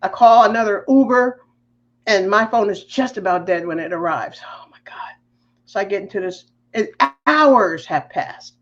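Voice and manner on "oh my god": very soft whispered aside; whispered dismay. Retold or imitated. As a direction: whisper it under your breath in dismay. Aside on dying phone, whispered.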